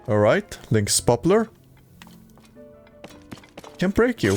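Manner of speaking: ordinary delivery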